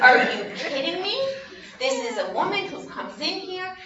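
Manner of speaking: high, loud mock outrage; freaked out. Pharisee reacting to the woman at the party, freaked out. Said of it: "He freaked out".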